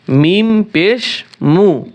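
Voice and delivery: মিম পেশ মু stretched very slowly, each syllable held; slow teacher's demo of মিম পেশ মু; slow drawn-out drill. Shown as a demonstration. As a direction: draw মিম out long and clear for the student to copy.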